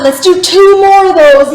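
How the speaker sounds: normal talking voice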